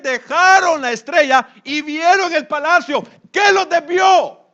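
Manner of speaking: loud, high sermon building toward a climax; preaching at full force. Acted. Pastor thundering at his congregation about leaving the star. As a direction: drive the point home with rising fire.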